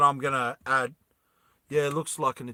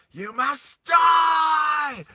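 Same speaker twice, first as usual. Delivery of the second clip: high, drawn-out, hammed-up battle cry; unhinged villain shriek; 12 semitones higher, 5 dB louder, much slower than usual. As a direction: lose it completely as the evil queen. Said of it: "she loses it".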